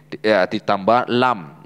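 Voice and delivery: ordinary talk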